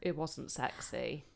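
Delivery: low, soft and slow; low quiet voice. Shown as a demonstration. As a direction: keep it low and hushed. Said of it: "I was talking like this".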